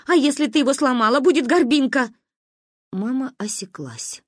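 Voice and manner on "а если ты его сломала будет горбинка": louder, higher, quick-fire worry; alarmed scolding. A narrator's reading. Alarmed mother scolds hurt girl.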